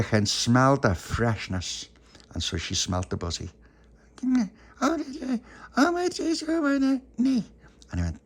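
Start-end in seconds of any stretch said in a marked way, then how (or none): 4.8-7.4 put-on very high, slow baby voice. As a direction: squeak it out like a toddler.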